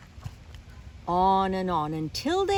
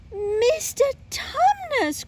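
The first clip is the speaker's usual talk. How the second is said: high, slow, loud cry of a name; Lucy's cry; 7 dB louder, slower than usual. Acted. Lucy sees her friend turned to stone, crying out high. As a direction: call out in shocked dismay. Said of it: "cried Lucy".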